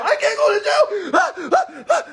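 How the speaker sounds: high, whiny mock panic; mocking scared whiner. Mocking the audience, a high whiny panic. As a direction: squeal it like a scared kid. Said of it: "y'all so scared to go to jail".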